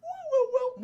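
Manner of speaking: high hooting chirp; little creature's high warble; imitated dinosaur call. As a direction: hoot like a small dinosaur.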